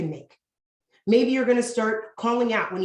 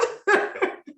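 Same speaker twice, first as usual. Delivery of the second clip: breathy high laugh; short airy chuckle; amused laugh; 11 semitones higher than usual. Real, not acted. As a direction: laugh at the joke. Laugh after joke about notes.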